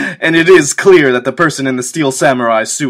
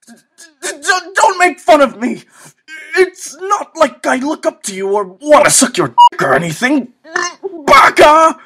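put-on very high, loud flustered anime girl; high, flustered tsundere outburst; 4 dB louder than usual. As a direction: squeal it out embarrassed. Anime tsundere impression after moe talk.

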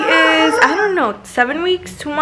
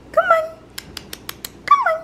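very high squeaky call to coax the dog; very high coaxing call to the puppy; 4 dB quieter, much faster than usual. Real, not acted.

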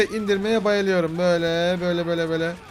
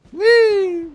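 high, loud gleeful squeal; gleeful whee; 13 semitones higher, 8 dB louder than usual. Real, not acted. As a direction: whoop with joy. Joyful jump in the game. (neşeyle tiz bir çığlık)

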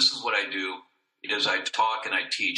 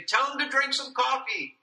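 high sing-out, as if from across the room; pitched way up, playfully relayed; joking background relatives. Retold or imitated. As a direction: imitate relatives calling a message from the background. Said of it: "they were always joking and in the background".

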